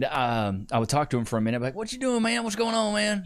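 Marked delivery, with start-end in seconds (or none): 1.8-3.2 high, casual imitation of the dad greeting his son; dad impression. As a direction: put on the dad's voice, pitched well up. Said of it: "This is what my dad sound, dad sounds like".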